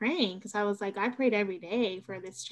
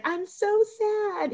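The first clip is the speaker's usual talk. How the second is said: put-on toddler voice; 12 semitones higher, much slower than usual. Quoting toddler son.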